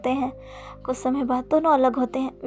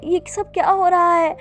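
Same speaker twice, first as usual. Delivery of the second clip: loud, higher distressed question; frightened outcry; 5 semitones higher, 5 dB louder than usual. A narrator's reading. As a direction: cry out, overwhelmed by the voices. Wing covers ears in fright.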